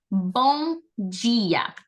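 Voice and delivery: Bom dia with a nasal bom and dia as jee-ah; Portuguese greeting. Shown as a demonstration. Teacher modelling Bom dia for the class, slowly. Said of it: "I'm gonna say once and you're gonna repeat it with me".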